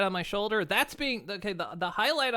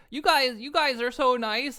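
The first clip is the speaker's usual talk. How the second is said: higher, louder, hammed-up choked-up gushing; higher, louder, hammed-up touched voice; mock teary; 6 semitones higher, 6 dB louder than usual. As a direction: pretend to be moved to tears. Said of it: "I can tear up".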